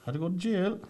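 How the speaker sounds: high put-on voice of a drunk traveller; traveller's voice. Friend retelling a traveller's jail story, high mock voice. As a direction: pitch the traveller way up and answer bluntly. Voicing traveller answering questions.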